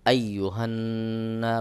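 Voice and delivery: slow "আইয়ুহান্না" with a hummed, held n; ghunnah. Shown as a demonstration. Teacher repeats the rule's example, "আইয়ুহান্না" with nasal hold. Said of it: "এটাকে বলা হয় ওয়াজিব গুন্নাহ".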